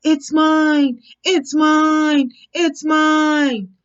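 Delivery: the toad mimics their shouting; mock shouting. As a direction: toad imitating the frogs' shouts. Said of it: "I can hear you shouting".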